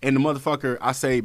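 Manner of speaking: ordinary talk, nothing marked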